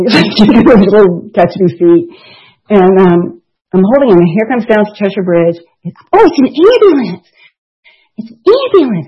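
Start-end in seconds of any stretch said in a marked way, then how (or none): 6.1-9.1 higher, louder delight; excited for toddler. Retold or imitated. Mother to her toddler son, pitched-up excited. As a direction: thrill the little boy about the ambulance.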